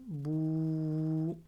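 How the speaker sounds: BOU with a very long held vowel; prolonged dhamma. Shown as a demonstration. Teacher showing waw lengthening the letter, long held "bou". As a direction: stretch "bou" out slowly on one steady note. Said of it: "prolonge une lettre qui est avant".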